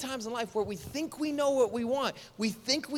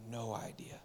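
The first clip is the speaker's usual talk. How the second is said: dropped to a hushed whisper; whispered punchline to the crowd; 9 semitones lower, 17 dB quieter than usual. Real, not acted. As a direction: whisper it like a secret. Dramatic sermon punchline.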